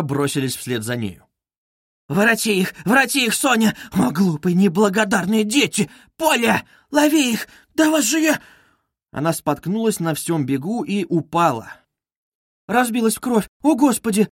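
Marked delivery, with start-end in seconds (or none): 2.1-8.4 high, desperate calling, climbing in pitch; wailing. A narrator's reading. Mother chasing her runaway children, wailing. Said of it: "С воплем и плачем". 12.7-14.3 high, horrified exclamation; crying out. A narrator's reading. Sonya bending over the fallen woman, crying out. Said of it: "вскрикнула Соня".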